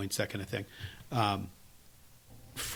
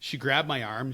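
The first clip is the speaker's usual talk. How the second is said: ordinary talk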